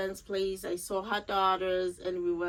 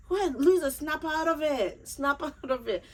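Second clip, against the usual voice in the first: scolding herself; 7 semitones higher than usual. Retold or imitated. Talking to herself after her friend's reminder, playful scolding. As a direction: give yourself a mock telling-off.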